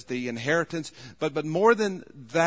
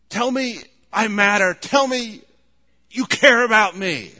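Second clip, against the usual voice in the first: pleading cry; 7 semitones higher, 10 dB louder than usual. Acted. Jacob pleading with his father.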